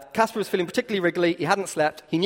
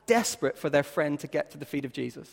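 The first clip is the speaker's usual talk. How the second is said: rising, emphatic preacher voice; preaching; 4 semitones higher, 5 dB louder than usual. Acted. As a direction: preach it out with fire. Preaching climax at full force.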